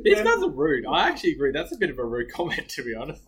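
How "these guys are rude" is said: mock offended.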